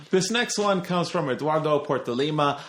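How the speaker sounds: lightly put-on Brazilian accent; half-hearted try at a Brazilian accent; attempted Brazilian accent. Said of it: "I tried doing the accent".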